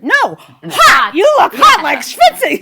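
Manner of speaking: high, loud, exasperated mock correction; loud retold correction. Correcting a girl in long sleeves, loudly exasperated. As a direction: blurt it out to clear up a comic mix-up.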